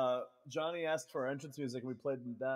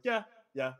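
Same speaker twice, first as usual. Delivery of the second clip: higher, louder imitation of keen assent; eager agreement; 7 semitones higher, 6 dB louder than usual. Bandmate Brennan answers the music idea, eager mock agreement. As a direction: jump on the idea, mimicking a partner.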